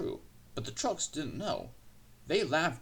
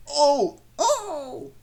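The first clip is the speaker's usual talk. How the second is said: high, loud shrieked "oh"; screaming trucks; 14 semitones higher, 9 dB louder than usual. A narrator's reading. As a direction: scream out in squeaky alarm. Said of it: "they screamed".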